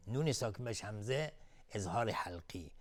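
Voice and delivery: normal talking voice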